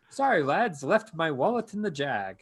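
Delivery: high, light mock British accent; chirpy put-on British lad. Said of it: "say in a British accent".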